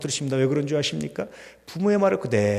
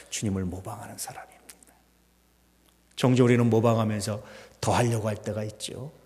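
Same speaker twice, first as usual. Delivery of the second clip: ordinary talk, nothing marked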